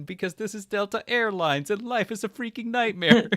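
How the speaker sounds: high-pitched, exaggerated comic declaration; mock mean airline staff. Airline agent answering a traveler, hammed-up mean. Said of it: "were just being mean to him".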